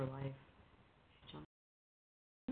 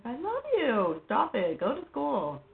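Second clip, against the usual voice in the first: daughter's brush-off; 7 semitones higher, 4 dB louder, slower than usual. Retold or imitated. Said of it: "She's like".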